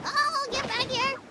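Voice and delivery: high, loud, sudden yell; urgent high-pitched holler; calling after escaping quarry. Real, not acted. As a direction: shout after something getting away.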